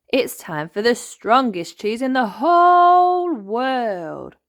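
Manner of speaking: slow, loud grand announcement; dramatic Grampy Rabbit. Acted. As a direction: build suspense for the cheese.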